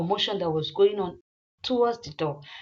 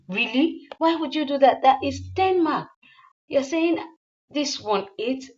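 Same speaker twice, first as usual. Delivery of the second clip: higher, louder offended disbelief; indignant surprise; 6 semitones higher, 3 dB louder than usual. Acted. Zane protesting eight out of ten.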